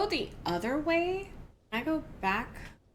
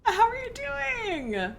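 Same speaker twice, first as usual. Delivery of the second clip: lightly higher, slow sweet greeting; high sing-song; 10 semitones higher than usual. Retold or imitated. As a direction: coo it like a cute bot.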